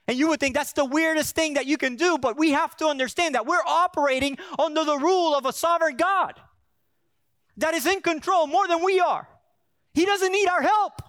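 loud, high, driving sermon voice; preaching at full force. Acted. Pastor preaching hard to the congregation.

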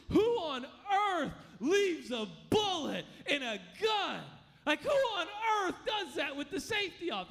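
loud, high, exasperated outburst; indignant shout. Retold or imitated. Startled friend blames the others, loud indignant shout. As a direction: yell it at the idiot who left the gun loaded. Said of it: "And he looks at me and says".

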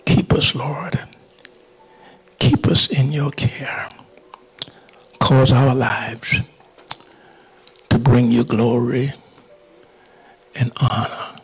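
impassioned pulpit prayer, swelling then settling; fervent prayer. Real, not acted. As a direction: plead with God on the congregation's behalf. Leads the congregation in prayer.

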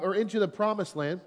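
usual speaking voice

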